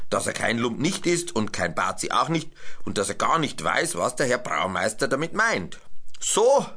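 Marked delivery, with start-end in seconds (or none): 6.2-6.6 sharp high "So" of the brewmaster; brewmaster's exclamation. A narrator's reading. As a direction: bark it out as the brewmaster. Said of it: "sagte Herr Braumeister".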